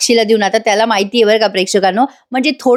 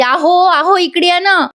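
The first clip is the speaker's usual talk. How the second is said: higher pitched call across the room; 7 semitones higher, slower than usual. Retold or imitated. Sayali calls her husband over, a raised higher call. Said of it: "अर्जुनला आवाज देते".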